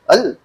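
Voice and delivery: EL with a quick upward flick of pitch; intonazione rialzata. Shown as a demonstration. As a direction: kick the tone up on EL. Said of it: "nggak perlu digituin".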